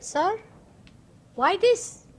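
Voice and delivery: high, loud disbelieving "why this?"; retold shocked question, pitched high; shocked exclamation. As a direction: rear back in mock astonishment. Retold shocked reply to fees.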